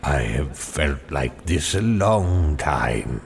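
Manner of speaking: a hint of a frail Father Christmas; lightly voiced feeble Father Christmas; feeble old man. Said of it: "in his feeble way".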